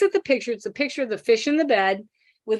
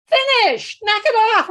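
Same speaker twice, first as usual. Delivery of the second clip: put-on much higher and louder, bossy kid; annoyed little boy; 11 semitones higher, 6 dB louder, slower than usual. Little boy telling the staring teachers to stop, cross and bossy. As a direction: play a cross little boy shooing everyone off.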